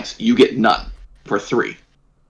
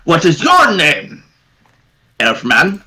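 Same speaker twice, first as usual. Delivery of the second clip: goblin voice; 5 semitones higher, slower than usual. Acted. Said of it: "the goblin goes".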